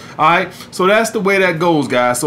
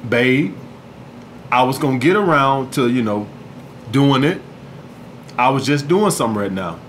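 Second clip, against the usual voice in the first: low, soft, unbothered reply; calm and cool; 6 semitones lower, 4 dB quieter than usual. Shown as a demonstration. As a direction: answer her complaint low and easy, no fight in it. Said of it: "like how I'm talking now".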